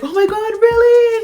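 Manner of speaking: imitated mom's high, loud squeal; very high excited mom reaction; super excited. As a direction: shriek it with delighted disbelief. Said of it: "super excited".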